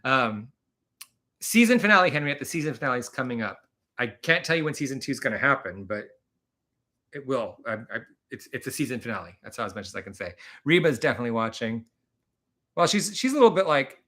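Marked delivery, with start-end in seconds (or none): none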